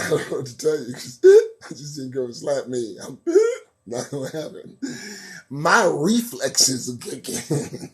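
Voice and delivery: talking through real giggles; laughing. Real, not acted. Host imagining being slapped himself, laughing as he talks. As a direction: keep breaking into laughter between phrases. Cracking up at the slap idea.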